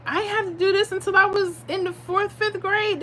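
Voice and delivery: incredulous outburst. Retold or imitated. A homeschooling mom complaining about hard schoolwork, high incredulous outburst. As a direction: squeal it in disbelief. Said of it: "I'm like".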